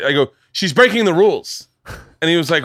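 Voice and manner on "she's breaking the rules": retold indignant protest, higher voice; mock outburst, pitched up; losing it. Tattling to the spin teacher, losing it. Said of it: "I lose it".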